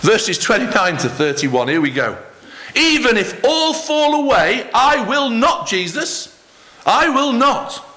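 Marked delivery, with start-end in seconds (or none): 2.7-7.9 a hint of proud Peter, pitched higher; mildly acted fisherman's pledge; Peter's bold vow. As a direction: lightly voice Peter boasting he'll never fall. Peter vowing loyalty to Jesus.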